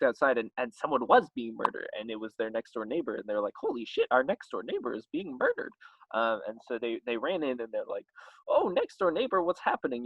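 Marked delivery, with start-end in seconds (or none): 3.6-5.7 high-pitched comic alarm; hammed-up horrified discovery; mock shock. Couple discovering the neighbour's murder, mock shock. 8.5-10.0 high, hammed-up concern; mock alarm. Couple rushing in on their neighbour, mock alarm.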